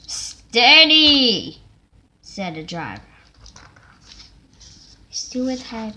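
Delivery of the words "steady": loud call. Acted. Driver calls to engine.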